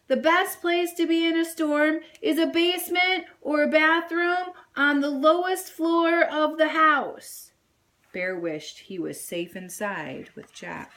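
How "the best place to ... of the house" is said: higher, louder explaining shout; yelling over storm. A narrator's reading. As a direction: shout the facts over the wind. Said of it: "Sadie yelled over the storm".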